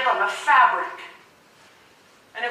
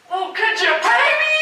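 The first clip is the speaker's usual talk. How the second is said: cheeky retort; 9 semitones higher, 3 dB louder than usual. Retold or imitated. Mocking reply to fans.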